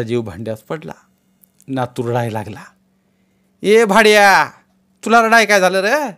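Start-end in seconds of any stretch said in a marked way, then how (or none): 3.6-6.1 high, loud affectionate scold; rough scolding. A narrator's reading. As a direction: scold the crying boy bluntly. Grandmother scolds crying grandson.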